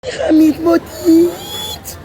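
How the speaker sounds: high, slow cracked voice; broken voice. Real, not acted. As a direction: strain through a broken voice. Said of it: "Vas-y, essaye".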